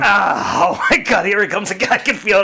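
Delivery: ordinary talk, nothing marked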